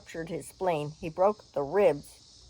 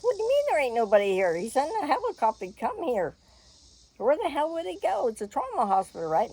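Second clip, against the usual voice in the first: raised voice; 8 semitones higher than usual. Retold or imitated. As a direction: push the words out fast, voice climbing. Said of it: "Now I'm raising my voice".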